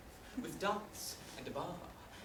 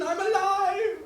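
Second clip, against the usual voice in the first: high, very loud outburst; indignant shout; 17 dB louder, slower than usual. Acted. Husband yelling at wife after car crash, indignant shout. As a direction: shout it in exasperated protest.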